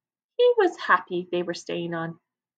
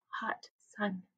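hushed whisper; 10 dB quieter than usual. Acted. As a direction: whisper the words, shivering. Dassy shivers in fear.

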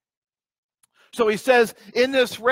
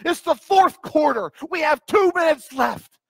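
high, loud fourth-quarter pep talk; football coach; 6 semitones higher, 4 dB louder than usual. Retold or imitated. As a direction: fire up the team, coach. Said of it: "It's almost like a coach saying".

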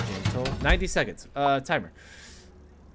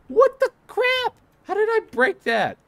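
1 baffled outcry; 6 dB louder than usual. Real, not acted. Confused by broken item.

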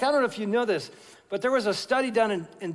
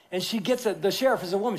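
usual speaking voice

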